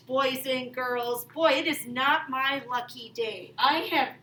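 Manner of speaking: usual speaking voice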